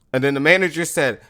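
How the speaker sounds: ordinary delivery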